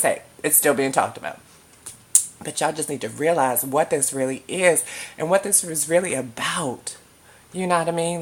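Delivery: ordinary talk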